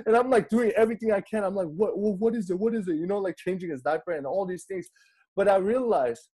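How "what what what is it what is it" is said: higher-pitched, flustered questioning; retold panic. Dad trying everything for his crying baby, mock panic. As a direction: act out a panicky new parent. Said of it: "I get all panicky".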